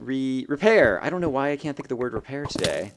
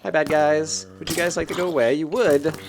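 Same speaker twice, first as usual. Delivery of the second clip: high, louder fake-polite taunt; mock sweet taunt; 5 semitones higher, 5 dB louder than usual.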